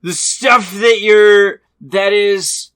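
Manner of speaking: put-on slow, loud drunken slur; drunk coach. Drunk coach gets up in the player's face, slurred and loud. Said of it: "He very drunkenly gets up".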